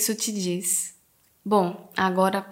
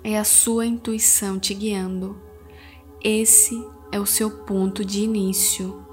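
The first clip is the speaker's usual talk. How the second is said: soothing spa whisper; 3 dB quieter than usual. Acted. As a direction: guide them gently into calm. Leads a guided meditation. (voz suave de meditação guiada)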